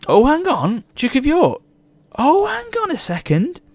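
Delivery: high-pitched, breathless-with-hope surprise; excited realisation. Real, not acted. Gamer spotting a long-wanted mod in the game, excited realisation. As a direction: pitch leaps right up as the hope dawns.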